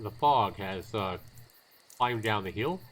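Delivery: normal talking voice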